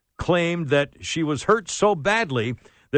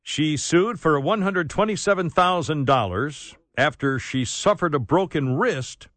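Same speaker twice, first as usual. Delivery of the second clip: ordinary talk, nothing marked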